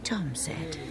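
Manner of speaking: hushed. Acted. Hushed gossip at village show.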